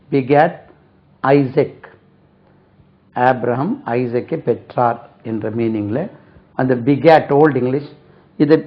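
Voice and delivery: usual speaking voice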